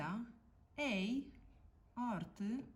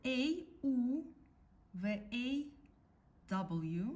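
ordinary talk

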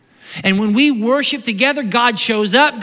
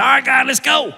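eager; 4 semitones higher than usual. Retold or imitated. Acting David's eager prayer.

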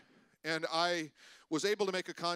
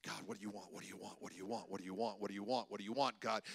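repeated soft pleading to God, much softer; hushed pleading prayer; 11 semitones lower, 10 dB quieter, faster than usual. Retold or imitated. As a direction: ask it again and again under the breath.

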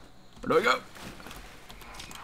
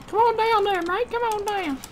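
falsetto call; 4 dB quieter than usual. Pretend. Calling in high voice.

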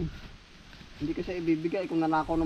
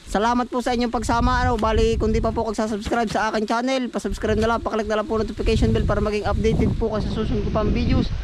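higher, projected sign-off to viewers; vlog outro; 5 semitones higher, 6 dB louder than usual. Real, not acted. Vlogger thanking viewers and asking them to subscribe, projected outro. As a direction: run through the subscribe plug in a raised voice.

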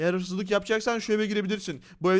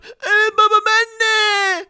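put-on high, loud childlike wail; squealed put-on kid voice; little son voice; 13 semitones higher, 9 dB louder, much slower than usual. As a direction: answer as an overjoyed small child. Son answers father.

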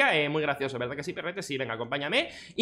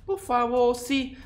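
villager voice; 6 semitones higher, much slower than usual. Acted. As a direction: plead like a simple earnest villager. Villager answering.

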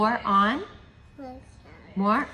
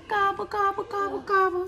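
turkey gobble; 9 semitones higher, faster than usual. Pretend. Parent voicing turkey to toddler.

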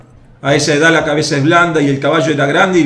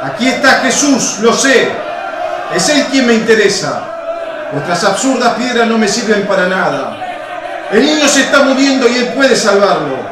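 furious stentorian shout; 7 semitones higher than usual. Acted. Roman soldier forcing his way into the temple, furious shout. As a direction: bellow insults to force a way in. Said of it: "estentoria e inquieta voz de hombre que blasfema".

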